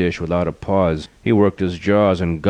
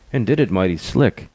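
ordinary delivery, nothing marked

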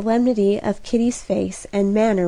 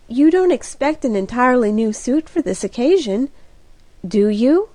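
usual speaking voice

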